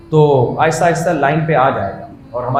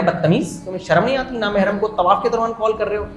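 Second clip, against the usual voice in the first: higher-pitched mock female reproach; imitated girl scolding; 5 semitones higher, 3 dB quieter than usual. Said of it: "لڑکیوں کی آواز بنا کر calls کیا کرتا تھا". (لڑکی کی آواز کی ہلکی نقل میں ڈانٹ)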